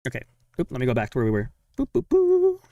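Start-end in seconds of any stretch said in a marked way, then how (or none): 1.8-2.6 squeaky high boop noises; high boop sounds. Real, not acted. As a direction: chirp it like a cartoon. Scrolling back through the video.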